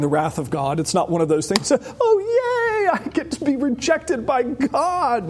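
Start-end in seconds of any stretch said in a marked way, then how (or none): 1.7-5.3 high mock cheer, dripping sarcasm; sarcastic yay. Preacher mocking a cheerful Jesus, sarcastic high cheer. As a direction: fake delight, squeaked high.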